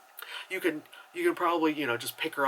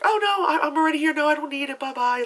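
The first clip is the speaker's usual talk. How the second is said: high, loud mimicry of a dismissive passenger; mock oblivious brush-off; 12 semitones higher, 6 dB louder, faster than usual. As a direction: brush the caller off in a put-on high voice.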